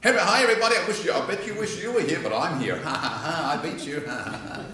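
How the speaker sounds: loud, high, gloating performer; mocking a smug gloating speaker; mock self-important show-off. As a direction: ham up a smug show-off crowing. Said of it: "Don't think, "Oh, I'm important".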